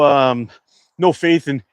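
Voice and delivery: ordinary delivery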